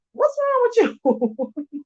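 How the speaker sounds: high, slow mock-shocked "What's wrong with you?"; exasperated disbelief. Vlogger mocking a kid's baby talk, shocked high voice. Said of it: "I'm like".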